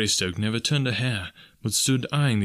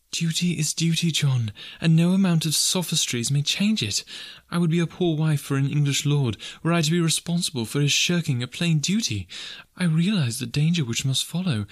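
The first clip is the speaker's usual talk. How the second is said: higher, firm voice of a dutiful wife; earnest young wife; 7 semitones higher than usual. Acted. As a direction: press him gently but firmly on his duty. Alice's lines in the story.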